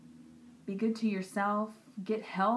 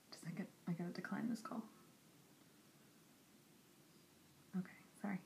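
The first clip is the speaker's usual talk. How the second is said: real whispered aside while declining a call; quick hushed aside, as if covering the phone; 16 dB quieter, much faster than usual. Real, not acted. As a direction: drop to a breathy whisper, off-mic.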